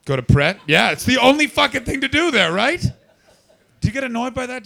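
shouting high and loud in disbelief; loud, sharp, disbelieving yell; incredulous shout. Real, not acted. Incredulous shouting at audience member.

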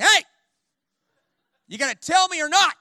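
loud, high demand; dad's shout. Retold or imitated. Dad bursting into son's room, shouting. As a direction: call him out like a fed-up dad. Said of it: "He's like".

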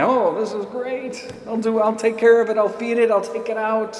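eager puppy. Retold or imitated. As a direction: gush like an excited puppy. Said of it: "like this little puppy running around thinking".

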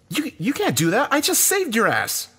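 high, outraged yell with moderate volume; scream. A narrator's reading. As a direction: protest in a high, strained cry. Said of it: "I screamed at him".

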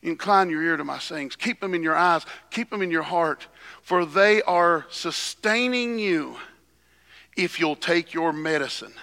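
ordinary delivery

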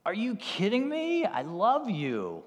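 loud, high disbelief, then a drawn-out declaration; big acted outburst of loving disbelief; incredulous love. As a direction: exclaim it, amazed and loving. God's astonished loving reply, acted.

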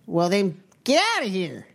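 a hint of a stern zealot; puritan woman voice. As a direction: wave them off coldly. GM plays puritan.

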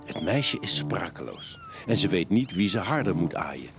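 normal talking voice

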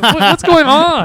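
drawn-out alarmed what's going on; bewildered demand to a stranger. Retold or imitated. To the intruder in the living room, loud shock.

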